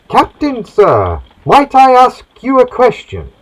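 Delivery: put-on cabin boy voice. Cabin boy asking his captain a question, high and eager. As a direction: pipe up like a nervous boy sailor.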